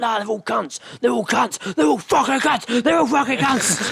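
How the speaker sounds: high, slow, repeated mock rant; imitating an angry caught thief; mock ranting man. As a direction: rant it out like a bitter shoplifter. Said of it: "he's like".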